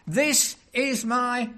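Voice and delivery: slow, high, loud proclamation; booming preacher's God; God the Father's voice. Acted. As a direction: proclaim it as a voice from heaven. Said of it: "It's God the Father's voice".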